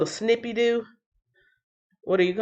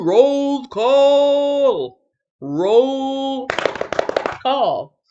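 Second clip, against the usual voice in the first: drawn-out, raised roll-call cry; announcer call; 5 semitones higher, much slower than usual. Real, not acted. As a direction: call it out long to the room.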